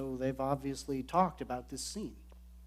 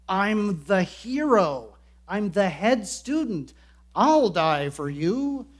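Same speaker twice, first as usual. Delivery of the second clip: boastful Peter; 5 semitones higher, 8 dB louder, slower than usual. Acted. Peter boasting, acted by preacher.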